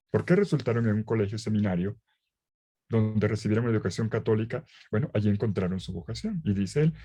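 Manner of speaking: ordinary talk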